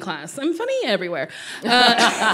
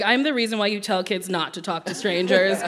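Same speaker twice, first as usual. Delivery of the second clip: usual speaking voice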